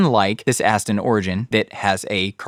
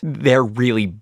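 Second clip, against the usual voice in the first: ordinary talk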